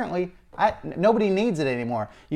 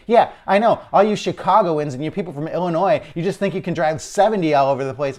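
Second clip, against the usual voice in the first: retelling the judge's loud, higher-pitched snap; a mock-angry judge scolding outsiders, raised voice; heated judge; 4 semitones higher, 6 dB louder than usual. Judge scolding the Illinois driver, angry and loud. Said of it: "He's already heated".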